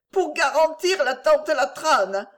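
high, plaintive protest; tearful. A narrator's reading. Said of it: "dit Clémence en pleurant".

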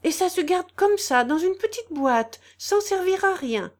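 high, loud, wide-eyed wonder; high-pitched puzzled amazement; naive astonishment. A narrator's reading. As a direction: marvel like an innocent who cannot grasp it. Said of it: "avec un étonnement naïf dépourvu de convoitise".

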